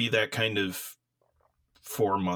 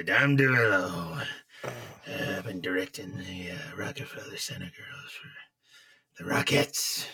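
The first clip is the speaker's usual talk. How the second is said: Dom DeMarco; 3 semitones lower than usual. Pretend. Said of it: "Just do Dom DeMarco for us".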